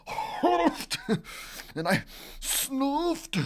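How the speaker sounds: high, gasping sneeze build-up. Acted. Wolf feels another sneeze coming, building up. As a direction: act out each huff and snuff.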